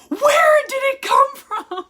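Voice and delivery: very high, loud, panicked shriek; panicked yell. Retold or imitated. Husband demands where the stretch mark came from, shrieking. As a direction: shriek it like a disaster just struck. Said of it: "And he's like".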